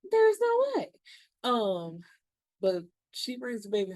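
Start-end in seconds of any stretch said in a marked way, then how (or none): 0.1-0.9 "There's no way" shrieked much higher and louder; outraged disbelief. Real, not acted. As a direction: squeal "There's no way" in shocked outrage.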